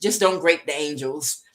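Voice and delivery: ordinary delivery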